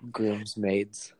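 ordinary talk

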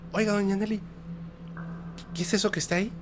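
high, soft, wary question; uneasy driver. Retold or imitated. Driver asks doña Nelly about a strange bag, high and hushed.

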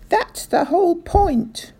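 high, loud outburst; cried out. A narrator's reading. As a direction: cry it out in exasperation. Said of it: "cried Mister Hazel".

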